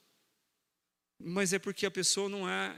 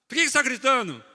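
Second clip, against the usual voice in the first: loud, high mock yell; 7 semitones higher, 12 dB louder, faster than usual. Preacher answers the yeller with a loud mock shout. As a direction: throw the shout back in mock outrage.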